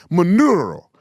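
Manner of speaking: loud Spanish trilled R on "Maduro"; mock Spanish accent. Shown as a demonstration. Host contrasting two versions, mock Spanish accent. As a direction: overdo the rolled R in a Spanish accent. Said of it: "It's not Maduro".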